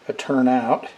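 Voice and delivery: usual speaking voice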